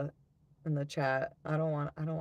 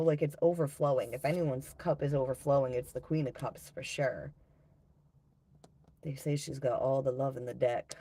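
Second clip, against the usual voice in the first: usual speaking voice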